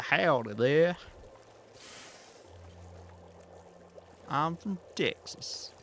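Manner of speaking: high Texan cowboy drawl; cowboy impression. Shown as a demonstration. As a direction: put on a hammed-up Texas cowboy accent. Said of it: "sometimes a bit cowboy-y".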